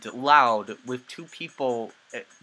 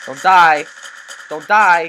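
yelling across the room; 4 semitones higher, 12 dB louder, slower than usual. Real, not acted. Teammate about to die.

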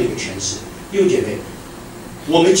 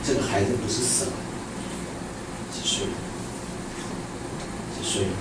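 much softer, Jesus speaking gently; tender hush; 9 semitones lower, 14 dB quieter, faster than usual. Acted. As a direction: hush the words like soothing a grieving father. Jesus's words voiced tenderly. (轻柔安慰地说)

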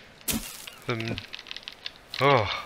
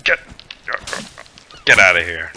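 loud, lower-pitched shout; shouting it away; 3 semitones lower, 7 dB louder than usual. Real, not acted. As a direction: yell to drive it off. Chasing something off in game.

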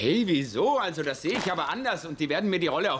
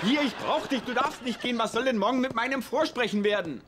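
desperate shout; 6 semitones higher than usual. Acted. Pleading not to be left.

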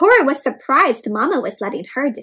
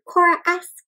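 ordinary delivery

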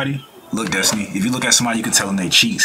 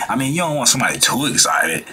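ordinary delivery

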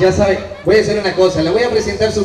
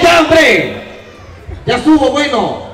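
annoyed shout; 4 semitones higher, slower than usual. Real, not acted. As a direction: shout him down, exasperated. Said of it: "Hasta la garganta se me está arruinando".

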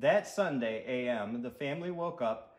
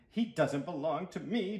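plain reading voice

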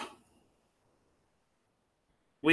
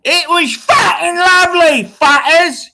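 put-on Brit accent; 11 semitones higher, 6 dB louder than usual. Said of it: "In a Brit accent again".

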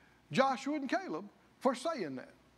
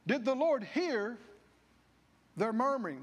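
ordinary talk, nothing marked

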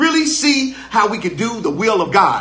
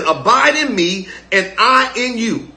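slow scripture reading; slower than usual. Shown as a demonstration. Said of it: "He says".

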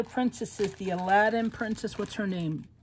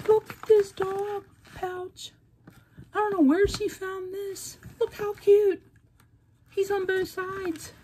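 much higher, gushing over a cute gift; excited squeal; 12 semitones higher than usual. Real, not acted. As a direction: squeal with delight at the pouch. Excited unboxing a gift pouch.

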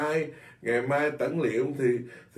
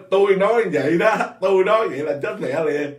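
mock proud boast, louder and higher; arrogant boast; 5 semitones higher, 6 dB louder than usual. Mocking a monk who claims his own word is law. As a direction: puff up and brag like a self-important monk. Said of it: "Còn ông nói".

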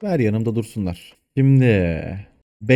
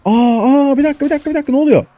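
much higher and louder, startled; shocked alarm; 14 semitones higher, 5 dB louder, faster than usual. Real, not acted. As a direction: caught completely off guard. Sudden surprise at enemy fire. (şaşkın, tiz bir panik)

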